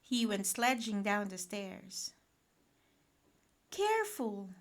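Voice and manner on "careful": raised-pitch motherly caution; warning Mum. A narrator's reading. Mum to boy sledging downstairs, warning. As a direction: call out a quick warning to the child. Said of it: "said Mum".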